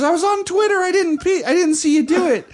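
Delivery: high, loud whiny girlfriend imitation; defensive protest. Girlfriend defending herself for not noticing, defensive. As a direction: imitate someone squealing an excuse.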